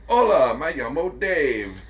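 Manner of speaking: hammed-up Spanish kid host; cheesy Spanish cartoon host voice; fake foreign language. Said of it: "and he'd be like".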